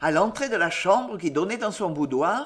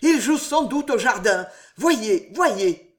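raised, higher urgent voice; frantic urging; 5 semitones higher, 5 dB louder, slower than usual. A narrator's reading. Father sends servants to the garden, frantic urging. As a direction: send them searching, loud and pressing.

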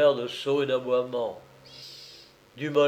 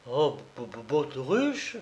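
softer, stuttering dismay; stammering; 4 dB quieter than usual. A narrator's reading. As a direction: stumble over the word in shock. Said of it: "balbutia-t-il".

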